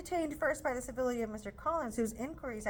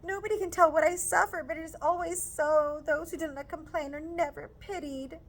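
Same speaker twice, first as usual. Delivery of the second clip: higher, louder, indignant scolding; scolding mother; 7 semitones higher, 6 dB louder than usual. Acted. Mrs Bennet scolding Lizzie for refusing, loud indignant scold. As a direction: scold the daughter hard.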